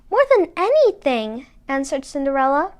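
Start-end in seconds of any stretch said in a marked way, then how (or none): 0.1-1.5 eager. A narrator's reading. Cinderella longing for the ball.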